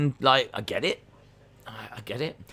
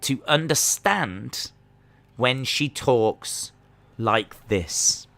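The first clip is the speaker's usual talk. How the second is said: deliberately slow, word-by-word delivery; talking slow; much slower than usual. Retold or imitated. Imitating a wrestler slowed down for easier understanding, very slow. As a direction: drag it out with pauses between phrases. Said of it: "told her to talk slow".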